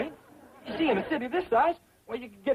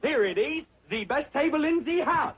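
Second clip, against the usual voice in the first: higher-pitched fake French maître d'; put-on French accent; 5 semitones higher than usual. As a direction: show guests in with hammed-up French accent. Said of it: "I forgot my French accent".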